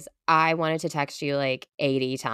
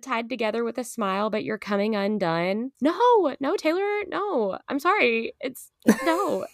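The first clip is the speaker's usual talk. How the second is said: 1 pleading high; 6 semitones higher than usual. Pretend.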